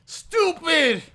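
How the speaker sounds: high, loud yell of annoyance; frustrated shout. Real, not acted. Player berating a bad play, frustrated shout.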